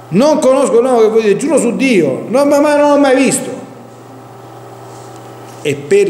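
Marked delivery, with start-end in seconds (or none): 0.1-3.5 preacher acting Peter's vehement, high-pitched oath; swearing denial. Preacher retells Peter denying Jesus in the courtyard, swearing and protesting. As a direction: deny it emphatically, pitch raised throughout. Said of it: "cominciò a imprecare e a giurare".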